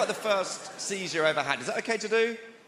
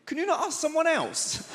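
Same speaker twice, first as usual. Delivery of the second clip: much higher, exasperated whine; high whiny inner voice; 13 semitones higher, 3 dB louder than usual. Retold or imitated. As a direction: think it in a high put-upon squeak. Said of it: "I remember just thinking".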